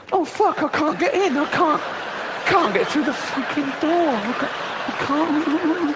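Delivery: mock frustrated fat shopper. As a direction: whine you can't fit through. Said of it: "They go".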